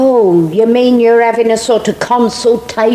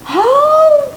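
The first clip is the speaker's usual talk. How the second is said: surprised oh. Acted. Finds a tin under pew.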